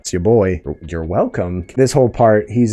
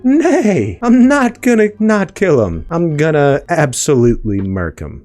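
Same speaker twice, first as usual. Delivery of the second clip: high, loud whinnying horse voice; horse impression; 9 semitones higher, 5 dB louder than usual. Sylvie as a crazy little horse Loki is reining, loud high whinny. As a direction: neigh the words out like a wild horse. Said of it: "That's my horse impression".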